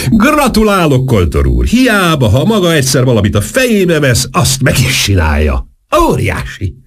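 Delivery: high-pitched, exuberant congratulations; thrilled, effusive outburst; excited yelling. A narrator's reading. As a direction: gush admiration, starting high and coming down. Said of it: "ordította".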